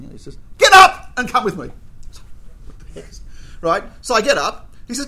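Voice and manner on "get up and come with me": barked order, much louder; stern waiter hauling a diner out; loud command. Retold or imitated. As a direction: order him up from the table.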